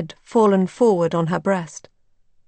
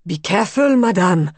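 louder urgent warning; urgent shout of caution; warning cry; 5 dB louder than usual. A narrator's reading. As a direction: warn her sharply.

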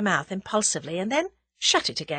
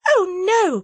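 high, louder protest; crying out; 11 semitones higher, 7 dB louder than usual. A narrator's reading. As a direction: blurt it out in alarm. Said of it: "cried Tuppence".

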